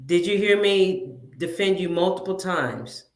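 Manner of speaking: ordinary talk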